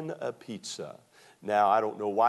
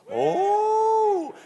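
excited whoop; 7 dB louder than usual. Real, not acted.

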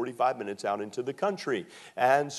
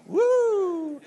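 excited whoop; 6 dB louder than usual. Real, not acted.

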